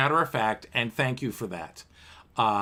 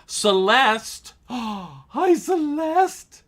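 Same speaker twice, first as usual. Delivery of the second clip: delighted greeting; 10 semitones higher, 6 dB louder, much slower than usual. Real, not acted.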